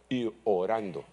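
normal talking voice

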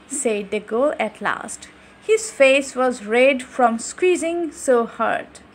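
usual speaking voice

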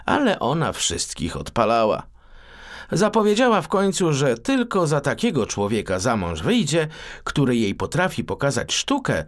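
usual speaking voice

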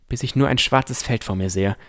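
usual speaking voice

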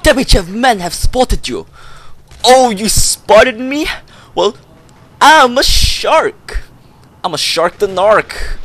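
loud, high, slow taunting shouts; excited yelling. Real, not acted. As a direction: yell it out to the enemy team. Taunting enemies in a shootout.

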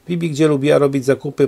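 plain reading voice

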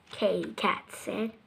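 ordinary delivery, nothing marked

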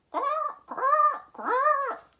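very high, loud quacking squawk; peacock squawk. Acted. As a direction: screech it like a startled peacock. Peacock's terrible noise.

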